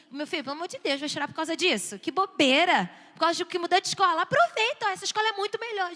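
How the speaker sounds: dismissive scolding. Acted. Parent dismissing child's tears over new school, exasperated. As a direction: brush off the tears impatiently. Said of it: "Não começa a dizer assim".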